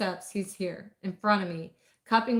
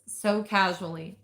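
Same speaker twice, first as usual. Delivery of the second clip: ordinary delivery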